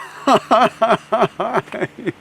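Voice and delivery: high, loud stuttering laugh; failed attempt at crying that bursts into laughter; laughing mock cry. Shown as a demonstration. Therapist trying to make herself cry, laughing instead. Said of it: "from laughing".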